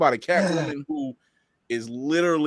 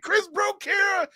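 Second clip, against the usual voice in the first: imitating gossipers, much higher; mock accusation. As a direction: shout the accusation in a mocking voice. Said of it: "they looking at me like".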